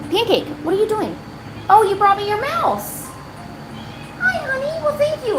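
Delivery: high sweet talk to a cat; pet talk voice. Real, not acted. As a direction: coo at the cat softly. Talking to her cat.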